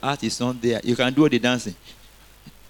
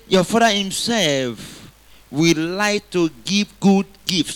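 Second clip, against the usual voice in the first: louder and slow, proclaiming each word; loud slow proclamation; 6 semitones higher, 5 dB louder, slower than usual. Shown as a demonstration. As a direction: proclaim it loudly and slowly to your neighbor. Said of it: "you say".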